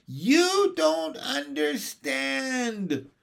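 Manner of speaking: high, long-drawn furious wail; furious roar. A narrator's reading. Baby John to his mother, roaring furiously. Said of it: "roared John furiously".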